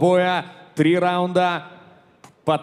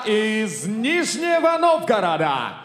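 boxing ring announcer; 7 semitones higher, slower than usual. Acted. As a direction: build to a roaring name call. Ring announcer calls red corner.

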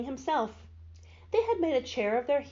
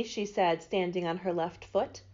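usual speaking voice

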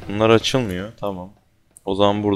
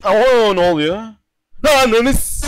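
terrified yelp; 12 semitones higher than usual. Real, not acted. Gamer ambushed in the maze, high frightened shriek. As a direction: shriek as the scare hits. Sudden jump scare.

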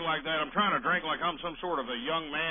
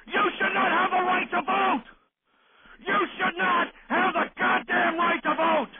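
high, loud, punched-out shouting; indignant yell, pitched way up; angry rant; 12 semitones higher, 4 dB louder than usual. Real, not acted. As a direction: hammer every word at full voice. Host ranting about entitlement collectors.

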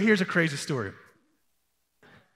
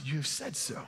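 soft reply; 5 semitones lower, 12 dB quieter than usual. A narrator's reading. Jesus answering Judas, retold softly. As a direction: say it quietly, without weight.

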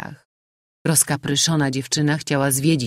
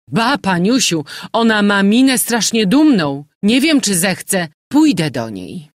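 louder, higher scoffing gossip; doubtful landlady; 6 semitones higher, 7 dB louder than usual. A narrator's reading. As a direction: shrug off the proud stranger.

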